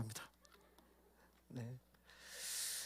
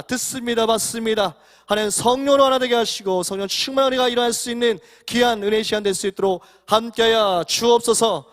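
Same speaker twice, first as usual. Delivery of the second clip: fervent congregational prayer, building louder and higher; public prayer; 3 semitones higher, 7 dB louder than usual. Real, not acted. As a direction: pray aloud with rising force to a ringing amen.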